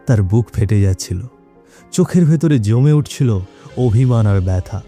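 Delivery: ordinary delivery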